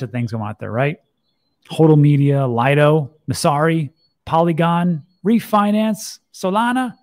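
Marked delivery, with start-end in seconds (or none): 1.7-6.9 voice climbing higher. Real, not acted. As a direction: list climbing step by step in pitch, starting loud. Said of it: "my voice could keep going up".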